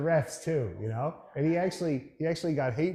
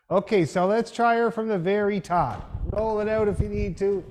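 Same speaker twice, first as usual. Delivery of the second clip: instructor cue; 6 semitones higher, 5 dB louder than usual. Acted. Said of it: "leads the class".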